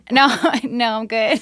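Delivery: laughing through a quick no, no; laughing refusal. Retold or imitated. Turning down the neighbor's castration offer, laughing it off. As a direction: decline while cracking up.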